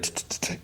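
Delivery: soft tongue-click tsks; mock doctor's tsk-tsk-tsk; imitated tutting. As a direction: tut three times, softly. Doctor hushing Nancy, retold.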